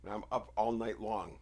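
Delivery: usual speaking voice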